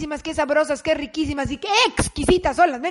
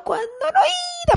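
hammed-up wail. Comic outburst.

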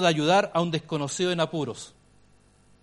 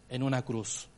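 ordinary talk, nothing marked

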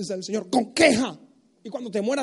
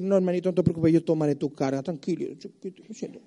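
mock tender consoling; 5 semitones lower than usual. Preacher mockingly consoling lazy brothers, tender. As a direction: coo like comforting a child.

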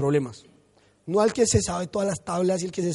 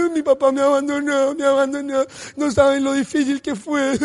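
high, loud, hammed-up whimper; mock sob to the congregation; mock crying; 5 semitones higher, 4 dB louder than usual. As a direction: whine it like a self-pitying child.

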